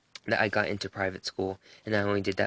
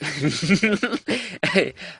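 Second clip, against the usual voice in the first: high, loud burst of giggling; real laugh; 9 semitones higher, 6 dB louder than usual. Real, not acted. Vlogger admitting she couldn't wait for donuts, laughing. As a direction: laugh out loud at your own confession. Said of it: "I don't know why I'm laughing".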